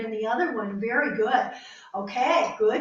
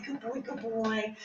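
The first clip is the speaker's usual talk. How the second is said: soft praise; 7 dB quieter than usual. Real, not acted. Trainer softly praising her dog, slow and tender. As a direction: coo it gently to the dog.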